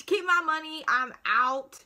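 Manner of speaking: loud, emphatic mock walkout; fed-up outburst. Patient wants to leave and cry freely, loudly fed up. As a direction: throw it out loudly and be done. Imagined impatient outburst at clinic staff.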